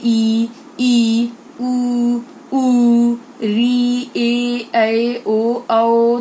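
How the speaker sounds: each vowel drawn out slowly and loud; long held vowels. Shown as a demonstration. Teacher to pupils, holding each Hindi vowel long and loud. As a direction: stretch every vowel sound long for the class. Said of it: "अगर मैं अ कुछ देर तक ही भी बोलते रह जाऊं".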